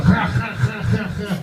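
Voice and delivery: repeated dry guttural "khh" rasps; mock Dutch by hacking from the throat, over and over; mocking throat noises. Greek relatives mocking his Dutch, repeated throat-scraping noises. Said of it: "they all mocked me".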